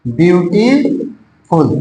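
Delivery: "Beautiful" drawn out slowly, with a gap before the last syllable; split into syllables. Shown as a demonstration. Teacher shows students how many syllables a word has, split into syllables. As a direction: stretch "beautiful" to show its parts. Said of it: "जब हम pronunciation करते हैं तो अधिक जाता है".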